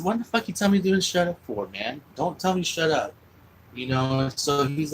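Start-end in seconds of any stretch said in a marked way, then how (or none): 0.0-3.1 retold argument, pitched much higher; angry retort. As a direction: snap back at a friend in a heated argument.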